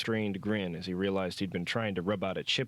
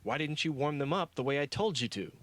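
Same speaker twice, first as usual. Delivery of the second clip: berating; 7 semitones higher than usual. A narrator's reading. Stein berating the foreman.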